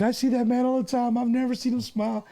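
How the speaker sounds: high, teary register lady; cashier's quote. Retold or imitated. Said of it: "She's like".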